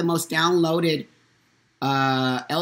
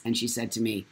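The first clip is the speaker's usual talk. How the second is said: normal talking voice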